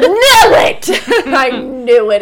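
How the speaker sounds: loud laughing "knew it"; real delighted laugh; laughing triumph. Real, not acted. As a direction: crow with laughter at being right. Listener guesses right.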